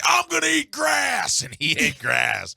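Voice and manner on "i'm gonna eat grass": much higher, yelled and drawn out; hyped shout. Retold or imitated. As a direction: yell it like a pumped-up contestant. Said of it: "one guy's like".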